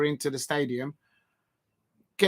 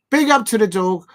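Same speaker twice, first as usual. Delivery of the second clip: excited shout-out; 6 semitones higher, 8 dB louder than usual. Real, not acted. Shout-out to his dog.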